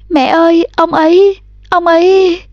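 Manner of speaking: too shaken to finish; panicked stammer. A narrator's reading. Girl too shaken to speak. (lắp bắp hoảng loạn)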